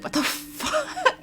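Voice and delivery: very high squeal dissolving into a laugh; shrieked disbelief. Retold or imitated. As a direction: squeak out the shock, then crack up. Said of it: "M C is like".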